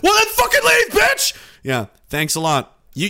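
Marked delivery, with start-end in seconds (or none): 0.0-1.3 very high, loud mock yell; husband shouting her out the door. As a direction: spit the insult back at her. Acted couple fight, the husband.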